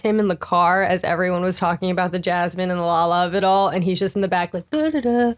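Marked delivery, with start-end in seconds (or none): 4.7-5.3 oblivious. Retold or imitated. Imitates oblivious man in the back.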